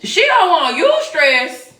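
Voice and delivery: shrieked high disbelief, slow at the end; outraged what, squealed way up; loud mock incredulity; incredulous shout. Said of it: "I'm like".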